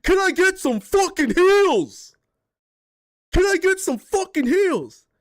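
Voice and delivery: high, slow whiny demand for heals; mocking angry teammate. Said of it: "had a guy go on the comms and be like".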